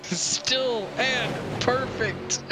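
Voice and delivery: stretched-out high silly call; slow high mock voice.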